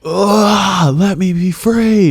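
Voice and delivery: high, loud, drawn-out mock wail. Friend prods him to raise his voice, hammed-up loud wail. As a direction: cry out melodramatically, stretching the words. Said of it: "Raise your voice a little bit".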